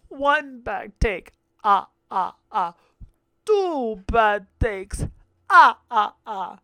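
slow, drawn-out counting with cackling "ah, ah, ah"; vampire counting voice, slow with loud cackles; Count from Sesame Street. Retold or imitated. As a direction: count the takes like the Count. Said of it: "starting to sound like the fucking Count".

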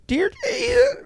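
sobbing start of a prayer, voice cracking; choked-up crying. Retold or imitated. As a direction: imitate someone breaking down mid-prayer. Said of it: "you couldn't make it".

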